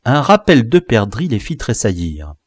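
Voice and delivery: plain reading voice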